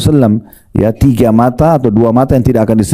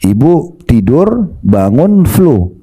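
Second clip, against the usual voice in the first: slow, drawn-out example; a preacher slowing down to paint the scene; slow storytelling; 2 semitones higher, much slower than usual. Shown as a demonstration. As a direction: lay it out slowly for the congregation. Slow example set-up in sermon.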